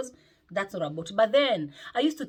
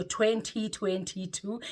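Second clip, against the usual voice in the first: slow, stretched twenty twenty-two; drawn-out year; much slower than usual. Shown as a demonstration. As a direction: savour each syllable of twenty twenty-two. Drawn-out year, her catchphrase.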